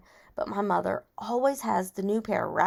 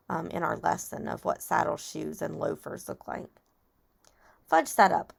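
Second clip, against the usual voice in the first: ordinary delivery, nothing marked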